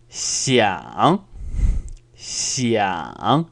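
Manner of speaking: "Xiang" dipping down, then swinging clearly up; exaggerated down-and-up on "Xiang"; emphatic third tone. Shown as a demonstration. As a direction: draw out "Xiang" with a full fall-then-rise tone. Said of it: "I've gone down and then up".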